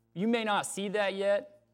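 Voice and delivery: ordinary talk, nothing marked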